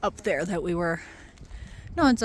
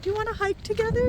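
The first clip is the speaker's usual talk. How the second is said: quick, higher-pitched quoted question; retold friendly invitation; 11 semitones higher, faster than usual. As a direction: imitate her asking, pitched up and brisk. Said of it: "she's like".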